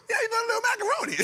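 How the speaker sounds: fast, high laughing shout; high fast laughing yell; shrieking laugh. Real, not acted. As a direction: squeal it out mid laugh. Mocking friend, shrieking laugh.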